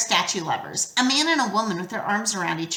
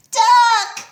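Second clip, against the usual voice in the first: much higher, much louder yell; 14 semitones higher, 8 dB louder than usual. A narrator's reading. Grover swooping in, yelling a warning. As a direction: scream the warning at full voice. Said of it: "he yelled again".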